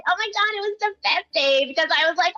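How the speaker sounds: very high cartoon voice all through. Shown as a demonstration. Voice actor answers the interview question on request, high cartoon voice. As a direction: stay in the squeaky cartoon character. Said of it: "answer the next question with this voice".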